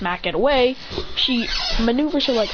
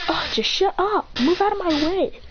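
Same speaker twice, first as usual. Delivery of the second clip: annoyed retort; 9 semitones higher than usual. Acted. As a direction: act fed up, pitched well up. Rival snaps back at insult.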